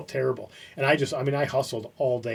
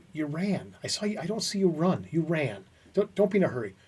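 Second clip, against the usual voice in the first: imitated boss, higher-pitched restrained reproach; pissed, not yelling; 4 semitones higher than usual. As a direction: needle the latecomer without raising your voice. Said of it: "he's just not yelling at me".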